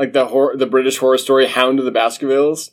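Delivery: fast, high, loud British storyteller parody; mock British accent. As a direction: gabble it as a clueless Brit. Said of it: "British people don't read horror".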